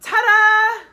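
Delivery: loud, high, held fanfare; triumphant reveal. A narrator's reading. Butterfly presents a found mother, loud triumphant flourish. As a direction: sing it out like a big reveal. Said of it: "says Butterfly".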